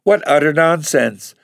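put-on a blushing girl protests, high and loud; indignant cry. A narrator's reading. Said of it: "cried Bella with flaming cheeks".

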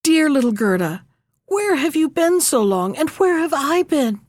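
high, happy calling out; joyful cry. Acted. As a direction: cry out her name in joy. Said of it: "cried out".

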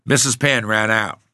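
plain reading voice

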